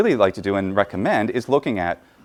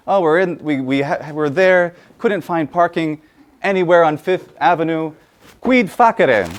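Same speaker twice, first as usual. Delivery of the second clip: higher, louder exasperated complaint; frustrated conversation; 6 semitones higher, 3 dB louder than usual. Pretend. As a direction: vent to a friend about parking. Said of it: "think of a conversation where we're telling somebody".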